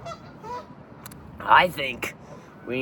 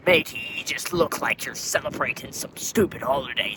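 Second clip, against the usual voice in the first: ordinary talk, nothing marked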